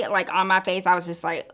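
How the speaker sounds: ordinary delivery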